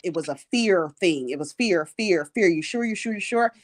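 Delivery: usual speaking voice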